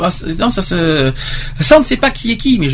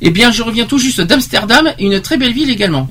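raised, animated reading voice; testimony read aloud; 5 semitones higher than usual. Acted. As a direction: read the anecdote out, lifting the pitch on the quotes. (lecture animée d'un témoignage)

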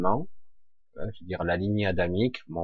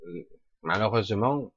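ordinary delivery

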